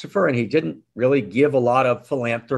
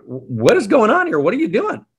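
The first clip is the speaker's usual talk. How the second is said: higher, louder demanding questions; exasperated; 9 semitones higher, 4 dB louder than usual. Acted. Rabbi confronting the butcher, exasperated and louder. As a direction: press him, fed up and baffled. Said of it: "quite exasperated".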